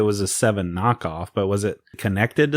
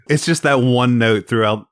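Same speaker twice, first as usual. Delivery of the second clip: ordinary talk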